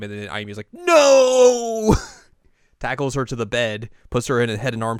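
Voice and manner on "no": high, loud, drawn-out scream; anguished shout. Acted. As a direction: cry out in hammed-up denial. Ayumi reacts before tackling Yu.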